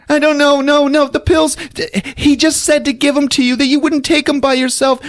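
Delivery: babbling excuses in a high voice; flustered Lisa. Retold or imitated. Lisa nervously explaining the pills to Sam.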